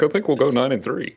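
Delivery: high, laughing imitation of friend; laughing mock impression. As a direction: tease him in a high mock voice. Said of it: "you're like".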